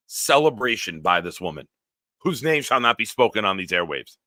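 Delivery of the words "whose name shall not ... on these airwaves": ordinary delivery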